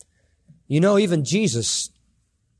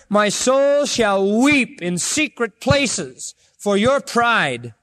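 higher, slow grieving preacher's cry; preaching lament; 8 semitones higher than usual. Acted. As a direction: mourn it from the pulpit, voice lifted high.

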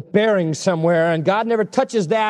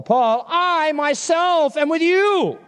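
"I myself" slow and high, preached with weight; slow, high preaching voice on "I myself"; emphatic I myself; 9 semitones higher, much slower than usual. Acted. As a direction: lift and stretch "I myself" as God's promise. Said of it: "what is implied in "I myself".

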